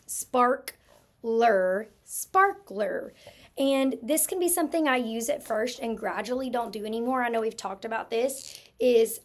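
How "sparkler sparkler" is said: "sparkler" said in two clear parts, rise then fall; teacher segmenting syllables aloud. Shown as a demonstration. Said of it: "chop out or segment my syllables".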